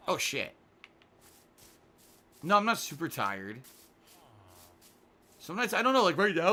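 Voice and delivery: normal talking voice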